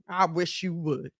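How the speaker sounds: low, soft, cool challenge; sassy dare. Retold or imitated. A confident woman daring the room, low and cool. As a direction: say it like a quiet dare. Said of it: "walk in a room ... and say".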